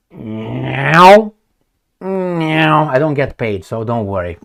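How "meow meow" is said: cat meow. Pretend. Imitating a cat.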